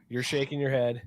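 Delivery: ordinary talk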